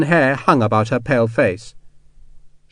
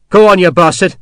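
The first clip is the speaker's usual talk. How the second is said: loud, higher, daring him to shoot; defiant snarl; 6 semitones higher, 7 dB louder than usual. Acted. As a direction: spit it at the man with the musket. Sharp defies a sepoy's musket.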